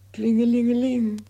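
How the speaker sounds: high, loud sung ring-a-ling; imitated bell ringing.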